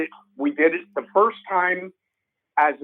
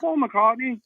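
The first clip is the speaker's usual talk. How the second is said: [name]; 8 semitones higher, slower than usual. Retold or imitated. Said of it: "He goes".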